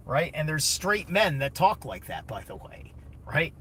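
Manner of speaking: ordinary talk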